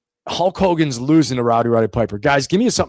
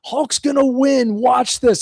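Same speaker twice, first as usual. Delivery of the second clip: put-on older brother; 11 semitones higher, 3 dB louder, much slower than usual. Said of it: "He goes".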